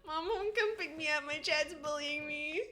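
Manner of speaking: high, laughing fake sob; mock-crying whine. Streamer pretending to call mom over bullying chat, mock-crying whine. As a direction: whine like a bullied child, half laughing.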